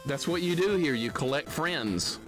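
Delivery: ordinary delivery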